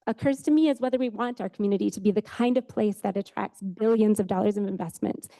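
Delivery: ordinary talk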